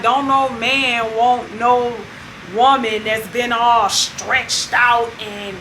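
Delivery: slow, drawn-out mock boast; lightly voiced cocky man laying it on slow; blunt boast. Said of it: "he was kinda going in pretty hard".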